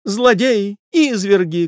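high-pitched outraged mistress; high, distraught exclamation; lady crying out. A narrator's reading. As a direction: cry accusations in a high lady's voice. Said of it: "кричала дама".

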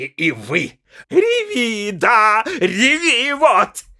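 mock her tears as you yell; drunken scolding. A narrator's reading. Пьяный муж ругает жену. (презрительная брань)

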